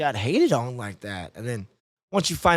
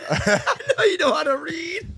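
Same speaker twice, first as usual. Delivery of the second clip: hiccup giggles; 12 semitones higher than usual. Real, not acted. Mocking echo while laughing.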